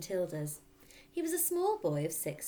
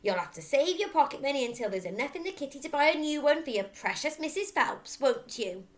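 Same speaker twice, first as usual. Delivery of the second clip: sneering taunt; 8 semitones higher, 9 dB louder than usual. A narrator's reading. Father taunting Matilda.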